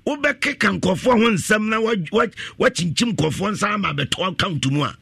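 high-pitched, insistent preaching; impassioned preaching. Real, not acted. Preacher warns congregation about getting wealth unrighteously, preaching hard. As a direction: press the warning home from the pulpit.

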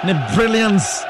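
usual speaking voice

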